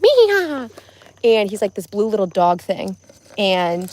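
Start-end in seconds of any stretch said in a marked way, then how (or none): 0.0-0.7 high, loud mock meow; playful high meow of a game character; cat meow. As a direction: squeak it out like a cartoon cat. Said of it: "And he goes like".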